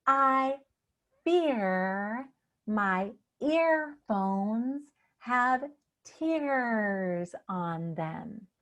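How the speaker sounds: very slow, stretching the ear in "fear", "earphones", "tears"; slow tongue twister. Shown as a demonstration. Coach modelling a new tongue twister for you, very slow. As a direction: draw out each word, lingering on the ear sounds. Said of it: "do it with me".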